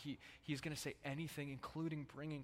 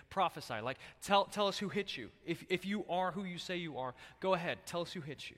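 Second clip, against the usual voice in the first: mocking taunt; 4 semitones higher, 6 dB louder than usual. Guards mocking Jesus.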